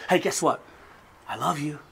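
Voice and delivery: soft, tender whisper of love; tender I love you. Retold or imitated. Father telling his sons tenderly he loves them. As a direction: say it gently to your boys. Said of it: "I walk up to them and I say".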